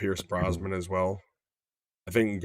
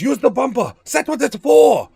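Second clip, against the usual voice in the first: high, loud, hammed-up yell at the other driver; mock shouted movie line; 13 semitones higher, 9 dB louder than usual. Bond yelling at a beeping driver, mock shouted. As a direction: bellow it like an action hero in traffic. Retelling GoldenEye tank chase line.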